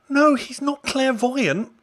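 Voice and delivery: high, loud, drawn-out objection; exasperated protest. Real, not acted. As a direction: protest loudly, spacing out the words. Heated rant about film's Sherlock.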